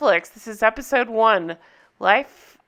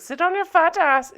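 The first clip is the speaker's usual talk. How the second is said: higher mock European accent; Anna's accent; 7 semitones higher than usual. Recapper confirming what the heroine says, high mock accent. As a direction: repeat the insult in a high mock-German accent. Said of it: "She says".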